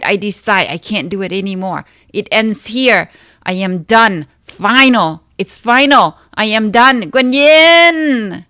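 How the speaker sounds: loud, high, anguished crying out; desperate yell. Acted. Yelling to Guanyin at the end of her rope, anguished shout. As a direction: scream that you give up, voice straining. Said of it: "I yelled deeply inside".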